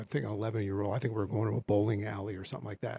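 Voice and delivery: ordinary delivery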